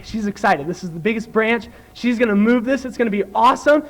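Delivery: ordinary talk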